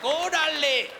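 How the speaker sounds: punchy, high Chicano "Órale"; Mexican slang. Retold or imitated. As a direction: toss off "Órale" like a cool kid. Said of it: "they say weird shit like".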